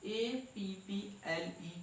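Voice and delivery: ए पी पी एल ई, every letter said separately and slowly; spelled letter by letter. Shown as a demonstration. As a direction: spell ए पी पी एल ई one letter at a time. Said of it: "ऐसे नहीं कहोगे ए double पी एल ई". (अक्षर-अक्षर अलग बोलना)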